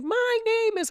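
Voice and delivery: imitated client speaking up, very high-pitched. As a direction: push the voice way up high, mimicking her. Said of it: "Louder, speak".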